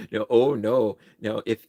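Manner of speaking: ordinary delivery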